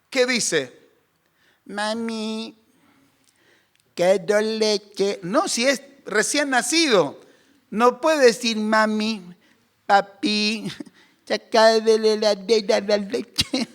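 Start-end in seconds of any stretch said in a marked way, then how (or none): 1.7-5.2 put-on soft, slow little-child voice; drawn-out toddler request, gently put on; mock baby asking nicely. Pretend polite newborn asks for milk. 8.6-13.7 lightly voiced little child; a hint of babyish pleading, played for laughs; polite tot mumbling a request; mock toddler request. Absurd talking newborn, played for laughs.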